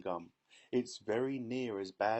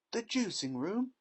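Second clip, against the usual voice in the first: much higher shocked question; alarmed cry; 11 semitones higher, 4 dB louder than usual. A narrator's reading. Mother hearing daughter's fate, shocked cry. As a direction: cry out in disbelief. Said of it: "cried Missus Beauregard".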